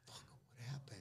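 hushed, breathy morning-after whisper. Retold or imitated. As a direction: whisper it like you're afraid of the answer. Hungover Kurt asking the morning after.

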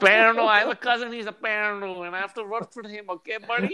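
high, put-on Indian uncle accent, teasing; mock Indian accent. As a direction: mimic a desi uncle scolding a buddy, high-pitched. Continues the uncle impression.

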